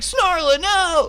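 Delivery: high, loud melodramatic shout; anguished cry. Acted. Companion watches his princess go crazy, hammy high shout. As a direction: cry out in hammed-up horror. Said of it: "And he's like".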